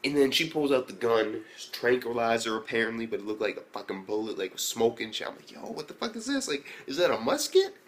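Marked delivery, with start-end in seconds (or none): 5.5-7.7 high-pitched stunned disbelief; shocked. Retold or imitated. Gamer shocked by the gun, high baffled squeal.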